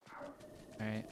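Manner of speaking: breathy whispered all right; whispering. Real, not acted. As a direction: whisper it while sneaking. Sneaking up on fairy.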